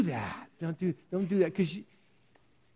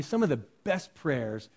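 normal talking voice

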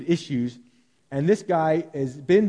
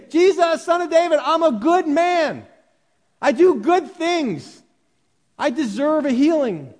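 loud, high, slow boastful plea; mock version the beggar never said, loud and self-important; smug self-praise; 10 semitones higher, 6 dB louder than usual. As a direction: puff yourself up before Jesus. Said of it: "He doesn't say".

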